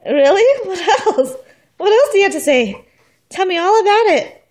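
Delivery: high, loud playful urging. Real, not acted. Mother loudly urging baby to keep babbling. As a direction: egg her on loudly.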